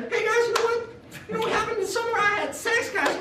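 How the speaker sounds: put-on very high squeaky kid bragging; little Joey. Little Joey bragging to his classmates, squeaky high boast. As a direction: squeak it out like a proud little boy. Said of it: "so he comes in".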